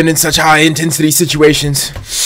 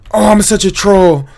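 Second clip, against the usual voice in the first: normal talking voice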